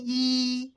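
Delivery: soft fake giggle into a held "eee"; forced giggle. Bride asked to look shy for camera, forced giggle. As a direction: giggle quietly on demand, badly faking shyness. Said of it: "சிரிக்க சொல்லலக்கா".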